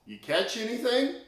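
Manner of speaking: calling out. Retold or imitated. Jesus calling to the boat.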